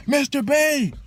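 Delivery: very high, insistent call of Mister Bay; eager kid calling. Retold or imitated. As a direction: squeak out the teacher's name. Kid tugging coattail voiced.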